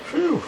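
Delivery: a high breathy phew of relief; relieved sigh. Real, not acted. Relieved after segment restart.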